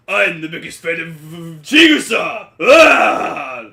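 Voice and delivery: loud, hammed-up superfan yell about Chigusa; deep manly fan voice. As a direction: bellow it like an overexcited fanboy. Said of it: "his voice was really deep".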